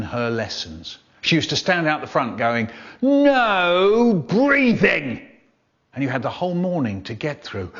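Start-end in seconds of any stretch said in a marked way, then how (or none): 3.0-5.3 very long drawn-out, high swooping command; strict teacher. Acted. Teacher standing out the front ordering the class, strict. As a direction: stretch each word like a tyrannical teacher. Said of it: "a teacher who was so strict".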